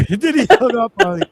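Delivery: amused laughing recap. Real, not acted. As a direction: laugh while telling it. Laughing at own story.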